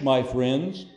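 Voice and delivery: ordinary talk, nothing marked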